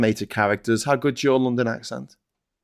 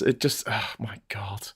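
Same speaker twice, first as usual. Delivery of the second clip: breathy whispered oh my God; whispered exasperation; 4 semitones lower, 9 dB quieter, slower than usual. Real, not acted. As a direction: sigh it out in a whisper. Exasperated aside, unvoiced.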